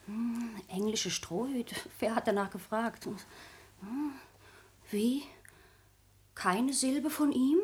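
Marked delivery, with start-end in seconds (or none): none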